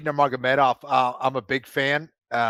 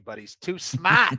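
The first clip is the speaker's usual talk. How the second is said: "smart" as Boston "smaht", no r; light Boston drawl on "smart"; Boston accent; slower than usual. Acted. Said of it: "I said smart".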